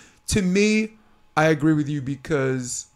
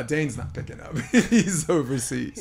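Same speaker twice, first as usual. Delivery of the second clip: ordinary talk, nothing marked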